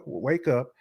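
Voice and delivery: normal talking voice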